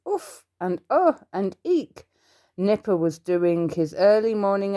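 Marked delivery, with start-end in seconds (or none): 0.1-0.4 high, happy little exercise grunt; a bright puff of effort; cheerful little oof. A narrator's reading. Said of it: "cheerful little grunts and puffs". 0.9-1.2 high, bright little exercise puff; a happy huff mid-stretch; cheerful little oh. A narrator's reading. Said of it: "cheerful little grunts and puffs". 1.6-2.1 high little exercise squeak; a happy squeak of effort; cheerful little eek. A narrator's reading. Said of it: "cheerful little grunts and puffs".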